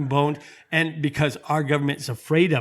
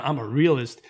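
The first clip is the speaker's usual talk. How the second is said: normal talking voice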